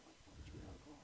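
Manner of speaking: hushed, breathy question; muttering at a player who left his car; whispered disbelief. Retold or imitated. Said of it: "I'm like".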